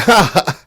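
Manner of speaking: imitated awkward laugh, high and loud; uncomfortable laugh. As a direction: fake an uneasy group laugh. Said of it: "That's sort of uncomfortable".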